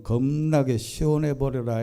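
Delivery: usual speaking voice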